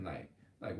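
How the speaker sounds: ordinary talk, nothing marked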